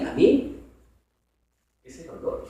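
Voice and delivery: ordinary talk, nothing marked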